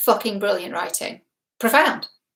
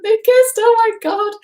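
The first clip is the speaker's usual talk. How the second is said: much higher, louder, thrilled squeal; excited squeal; 12 semitones higher, 4 dB louder than usual. Real, not acted. Fan squealing to viewers over the kiss, thrilled high squeal. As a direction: squeal with real delight.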